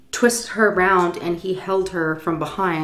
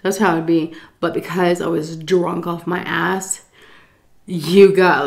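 normal talking voice

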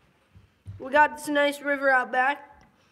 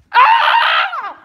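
startled shriek; 12 semitones higher, 10 dB louder than usual. Real, not acted. Bear thrown at her.

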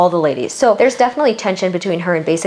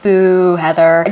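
louder group boo; a playful louder boo; mock booing; 5 dB louder, much slower than usual. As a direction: jeer her name. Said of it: "everybody's like".

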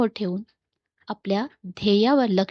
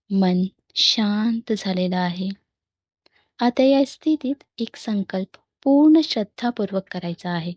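measured meditation guide voice with long pauses; guided meditation. Real, not acted. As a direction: guide listeners into calm, phrase by phrase. (ध्यान करवणारा शांत, संथ आवाज)

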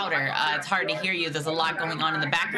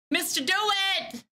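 high calls into an empty hold line; summoning the agent who vanished; calling out; 12 semitones higher than usual. Real, not acted. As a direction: call out to the missing agent, pitched way up.

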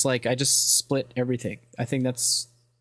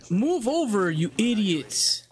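mock yell; 7 semitones higher, much slower than usual. Yelling at game characters.

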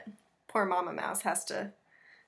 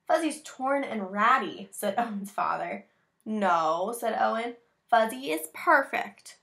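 put-on reading to children; 6 dB louder than usual. Reads picture book aloud.

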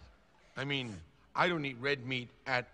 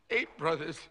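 breaking into tears; 9 semitones higher than usual. Acted. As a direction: list them, sobbing harder after each. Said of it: "Don't cry".

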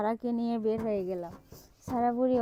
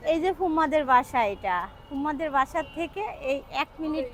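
bubbly, pitched-up delight; excited; 4 semitones higher than usual. Real, not acted. Excited street-food discovery.

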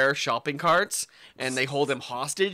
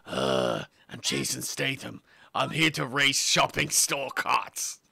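Jason Statham impression; 5 dB quieter, slower than usual. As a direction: drag it out like a cockney hard man. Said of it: "sounds exactly like the last voice you did".

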